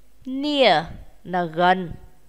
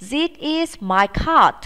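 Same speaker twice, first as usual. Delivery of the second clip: slow drill, lifted higher; slow higher classroom model; slow model sentence; 6 semitones higher, slower than usual. Shown as a demonstration. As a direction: say it slowly and brightly for pupils to copy. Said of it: "Chúng ta cùng nói nhé".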